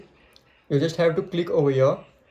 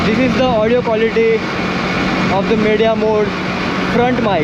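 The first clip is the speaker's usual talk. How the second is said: loud voice over wind noise; speaking loudly; 6 semitones higher, 5 dB louder than usual. Real, not acted. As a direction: raise your voice over the wind. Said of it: "I have to speak very loudly".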